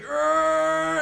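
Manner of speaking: high loud mock strain; hammed-up grunt of effort. Guard hanging off ropes, straining in a loud high grunt.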